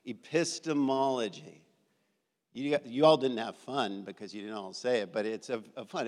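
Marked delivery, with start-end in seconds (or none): 0.0-1.6 say-along demonstration. Shown as a demonstration. Teacher leading the room in a fun word, "Epistemology" stretched out. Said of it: "so say it with me".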